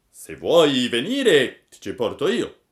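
loud, slow, swooping character voice; pigeon making an offer. A narrator's reading. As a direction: proclaim the offer, stretching the words.

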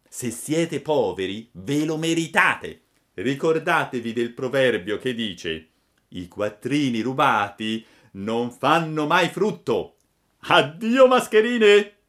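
a cheeky boy mocking two swindlers; scornful puppet. A narrator's reading. Pinocchio lectures the fox and cat.

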